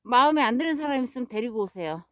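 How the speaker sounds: normal talking voice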